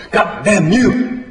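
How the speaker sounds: high-pitched, drawn-out slow curse; strained high yell, word by word; screaming. A narrator's reading. John aiming at the sow's head, screaming. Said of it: "John screaming".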